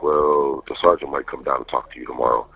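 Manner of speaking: ordinary delivery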